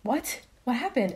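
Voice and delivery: high, puzzled what, what happened; retold confusion. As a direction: replay the moment it made no sense. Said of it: "And I was so confused".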